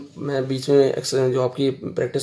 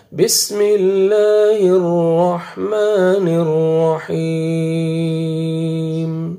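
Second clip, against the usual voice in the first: slow, raised melodic chant with long held vowels; Quran recitation; 6 semitones higher, 6 dB louder, much slower than usual. Shown as a demonstration. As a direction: recite in measured tajweed for learners to follow. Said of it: "شروع سے میں پڑھتا ہوں، آپ سنیے". (ٹھہر ٹھہر کر تلاوت)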